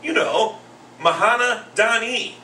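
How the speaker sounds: put-on high, loud, slow kid voice; little boy's inflection. Pupils tell teacher the boxer's name, high drawn-out kid inflection. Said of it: "when you hear the inflection".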